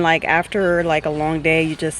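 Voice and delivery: ordinary talk, nothing marked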